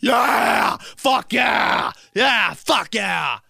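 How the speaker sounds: loud high shouting fan; mock fan cheering. Imitating football fans cheering, loud high shouts. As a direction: bellow like a fan after a score. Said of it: "are people just like".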